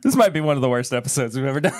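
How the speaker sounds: talking through laughter; laughing. Real, not acted. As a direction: say it while cracking up. Laughing at their own bad episode.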